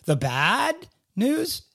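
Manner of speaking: The bad news? with news leaping high in a question; big rising question. Shown as a demonstration. Host mocking the inflection, news swooped up. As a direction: lift news way up, incredulous. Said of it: "inflection in my voice".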